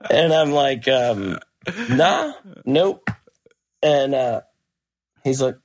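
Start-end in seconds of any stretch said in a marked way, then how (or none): 1.9-3.1 high, short dismissive no; lightly acted, high-pitched refusal; retold breezy refusal. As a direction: act out casually turning him down.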